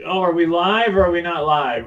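ordinary talk

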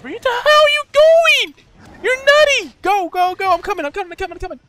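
high, loud panicked yelling at a teammate; real high-pitched gamer panic; frantic shouting. Real, not acted. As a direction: yell frantically as you rush to help.

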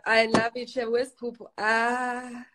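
usual speaking voice

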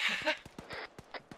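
unvoiced giggle; breathy laugh. Real, not acted. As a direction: laugh under your breath. Teammate laughs after joke.

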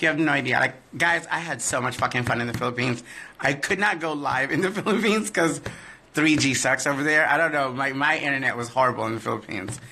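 ordinary delivery

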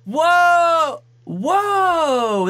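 high amazed whoa. Real, not acted. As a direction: gasp out in awe. Amazed at animatronic.

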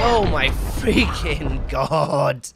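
very slow, stretched-out groan of words; drawn-out exasperation. Real, not acted. Gamer after being killed, slow drawn-out exasperation. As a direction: drag each word out in disbelief.

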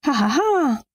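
high, spelled-out "ha ha ha"; performed high cackle; acted laugh. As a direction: let out a high, mirthless-sounding staged laugh. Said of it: "Wer lacht denn da?".

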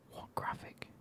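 usual speaking voice

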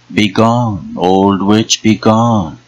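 high, loud jeering mockery; jeering echo. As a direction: throw the words back, mocking. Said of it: "she echoed, jeering".

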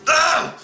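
high, exasperated ugh; disgusted groan. Real, not acted. Man who hates cats, disgusted groan.

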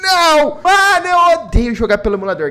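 loud furious gamer outburst; raging gamer. Real, not acted. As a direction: yell at the emulator in real frustration. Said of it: "Eu vou passar raiva".

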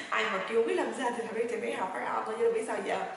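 ordinary talk, nothing marked

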